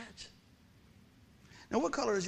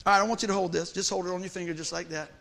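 normal talking voice

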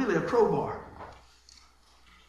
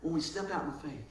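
When we step out in faith, repeated much softer; 5 semitones lower, 9 dB quieter than usual. Shown as a demonstration. Pastor echoing his point softly to the congregation. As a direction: drop to a soft echo of the line. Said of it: "repeats it softer".

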